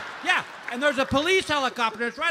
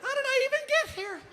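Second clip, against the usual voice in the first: shrill disbelief. Retold or imitated. Freaked out, disbelieving.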